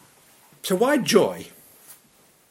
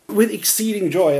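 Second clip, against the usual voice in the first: raised, louder, savouring "With exceeding joy"; joyful quote; 6 semitones higher, 4 dB louder than usual. Retold or imitated. Preacher quoting his dad's favourite verse with raised joyful emphasis. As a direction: relish the verse his dad loves, "With exceeding joy" ringing out. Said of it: "my dad said he, oh, he's lived off that verse".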